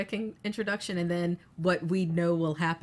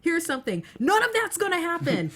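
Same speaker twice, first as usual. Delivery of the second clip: high, breezy teasing voice; smug studio tease; 11 semitones higher, 5 dB louder than usual. Pretend. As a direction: dangle it like a tease. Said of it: "Be like".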